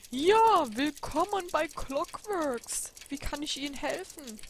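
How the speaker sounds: high, loud shopkeeper greeting, drawn out; a cheery old clockmaker welcomes a customer. Acted. Shopkeeper greets customer at the door, high and loud. Shop owner Frank greets.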